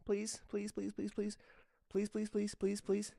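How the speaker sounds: high, loud coaxing plea to the cat; pleading pet voice. Real, not acted. Owner begging cat to swallow medicine, high pleading pet voice. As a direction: beg the cat in a squeaky pet voice.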